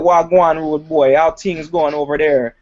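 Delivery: lively put-on Jamaican greeting; Jamaican accent. Comedian showing the accent he learned in Boston, mock Jamaican. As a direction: imitate an Americanized Jamaican accent. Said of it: "my Jamaican accent".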